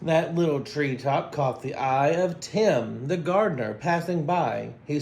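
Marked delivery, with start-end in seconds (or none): none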